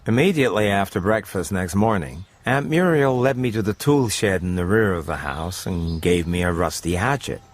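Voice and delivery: ordinary talk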